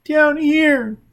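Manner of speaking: put-on high, loud, tearful child's wail; high sobbing child voice; weeping child. A narrator's reading. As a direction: cry it out like a scared boy. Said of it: "Sigurd wept".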